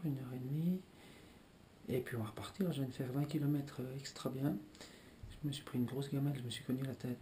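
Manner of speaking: hushed late-night whisper; breathy whisper so no one wakes. Real, not acted. Whispering at night while others sleep.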